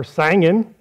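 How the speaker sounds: Southern "sangin'" with a wide a; Southern accent. Shown as a demonstration. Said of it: "pronounced in the South".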